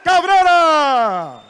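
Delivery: high, loud announcer shout; ring announcer shout. Acted. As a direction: shout the champion's name to the arena. Main fighter introduction.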